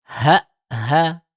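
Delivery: "Ha, ha" with a light nabr lift; nabr rise. Shown as a demonstration. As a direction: raise the voice slightly on the stop. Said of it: "langsung meninggi sedikit".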